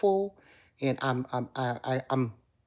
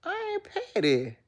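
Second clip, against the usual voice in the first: squeaky high sassy line; high singsong. Acted. To her live viewers, a high sassy voice. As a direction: pitch it way up, sassy.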